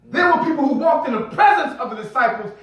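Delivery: high-pitched, driving sermon delivery; impassioned preaching. Real, not acted. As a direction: preach it to a responsive congregation, pitch lifted. Preaches at full force.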